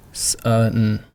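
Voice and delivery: sun with each sound held the same length; even-length sun. Shown as a demonstration. Teacher modelling sun with even sounds. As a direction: give every sound of sun equal time. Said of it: "three sounds in the word sun".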